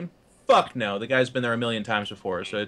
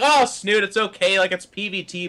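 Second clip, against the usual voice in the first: mock reassurance; 9 semitones higher, 4 dB louder than usual. Casters reassuring panicking colleague.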